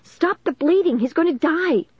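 high, hysterical cry; frantic wail, pitched far up; screaming. A narrator's reading. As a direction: scream it in desperate panic. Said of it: "Caitlin screamed".

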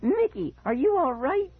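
very high put-on voice of exaggerated concern; high mocking shriek. Brother mimics sibling's worry, shrieking very high. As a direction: squeal the question in mocking mimicry. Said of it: "he shrieked in a high voice".

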